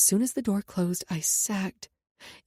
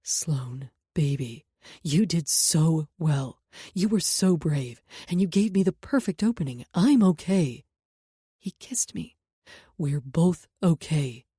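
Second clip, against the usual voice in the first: tender; 4 semitones lower, 4 dB louder, slower than usual. A narrator's reading. Jackson comforts crying Sloan.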